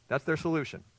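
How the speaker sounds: ordinary talk, nothing marked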